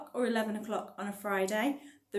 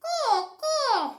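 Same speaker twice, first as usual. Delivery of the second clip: high-pitched parrot caws; parrot squawk; 6 dB louder than usual. A narrator's reading. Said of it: "said the parrot".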